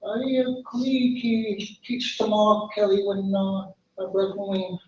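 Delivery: lightly acted timid plea; timid, shaking bird. Frightened little bird appeals to giant redwood, timid and soft. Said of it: "he starts shaking. And he's like".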